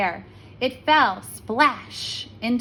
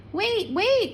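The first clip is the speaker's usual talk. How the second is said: calling out; 10 semitones higher than usual. A narrator's reading. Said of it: "cried the frog".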